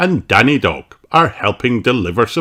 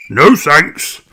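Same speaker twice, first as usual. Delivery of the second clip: quick no thanks; 8 semitones higher, 3 dB louder than usual. A narrator's reading. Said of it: "says Grandad Dog quickly".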